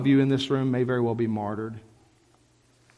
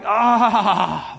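higher, drawn-out mock cry of fright; scared cry; 5 semitones higher, 5 dB louder than usual. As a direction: let out an acted wail of nerves. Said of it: "like, I'm so scared".

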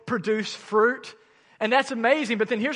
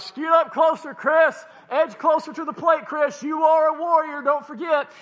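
pumped-up coach hollering encouragement; yelling from the dugout; 7 semitones higher than usual. Retold or imitated. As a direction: call it out across the field to Chris.

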